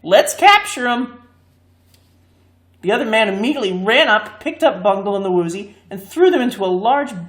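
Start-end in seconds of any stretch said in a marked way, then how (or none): 0.0-1.2 higher, louder bark of an order; rally your partner, loud; commanding order. A narrator's reading. Leader ordering the other man, loud and high. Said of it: "he said".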